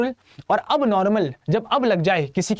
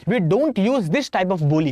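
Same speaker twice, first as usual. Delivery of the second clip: slow emphatic English; much slower than usual. Shown as a demonstration. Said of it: "अब ये बोली बोली नहीं जाती है".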